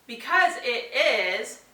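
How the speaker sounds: slow, rising, inviting the answer; slow sentence starter. Shown as a demonstration. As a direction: draw out the starter for the child. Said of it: "sentence starters".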